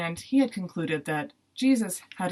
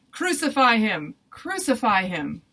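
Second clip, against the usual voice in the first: raised, drawn-out shout; crowd shouting; 4 semitones higher, 5 dB louder, slower than usual. A narrator's reading. Crowd insists on crucifixion over Pilate's protest, shouting. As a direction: call it out slowly as an insistent mob. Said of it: "they just shouted all the louder".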